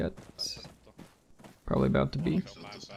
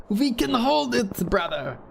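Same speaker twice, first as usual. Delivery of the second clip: much higher, slow hammed-up Russian accent; mock Russian accent; 10 semitones higher, slower than usual. Mocking the platoon sergeant to his squad, hammed-up Russian accent. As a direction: play a cocky Russian officer, slowly. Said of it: "It's so Russian".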